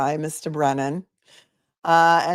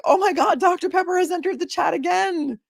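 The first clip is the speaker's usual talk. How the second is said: much higher, gushing fan voice; mock excitement; 11 semitones higher than usual. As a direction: squeal it like big news. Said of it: "and I'm like".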